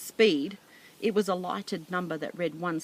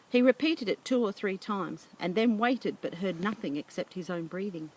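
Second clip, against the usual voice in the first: ordinary delivery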